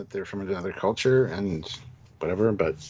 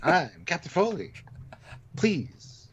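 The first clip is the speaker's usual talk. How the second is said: higher, broken-up line delivery; mock a stilted starship captain with long pauses; halting captain parody; 6 semitones higher, slower than usual. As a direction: deliver it in dramatic, halting chunks. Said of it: "saying the lines like this".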